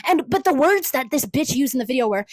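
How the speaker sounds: usual speaking voice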